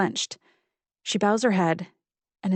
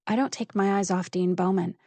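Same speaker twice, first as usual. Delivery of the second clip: ordinary delivery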